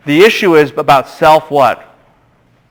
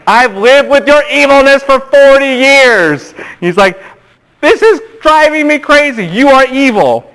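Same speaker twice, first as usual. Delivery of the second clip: Moses exasperated; 10 semitones higher than usual. Retold or imitated. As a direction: let Moses vent, fed up.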